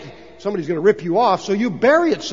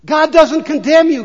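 declaring; 8 semitones higher, 7 dB louder than usual. Acted. Preacher declaring to congregation.

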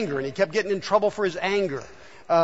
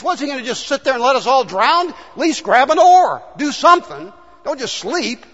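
frustrated disciples; 6 semitones higher, 5 dB louder than usual. Acted. Voicing angry disciples in boat.

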